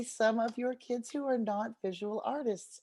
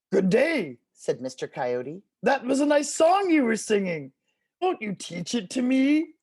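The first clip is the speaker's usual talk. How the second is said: coyote voice; 7 dB louder than usual. Acted. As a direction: sweet-talk like a crafty coyote.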